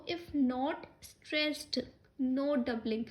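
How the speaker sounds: very slow, deliberate reading of the rule; slow dictation. Shown as a demonstration. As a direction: drag the words out for note-taking. Teacher dictates the fourth rule.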